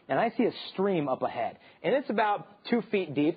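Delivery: ordinary talk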